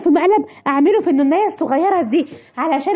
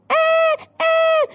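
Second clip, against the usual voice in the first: put-on short high baby cries; a thin crying whimper; baby cries after the scare; baby wail; 11 semitones higher, much slower than usual.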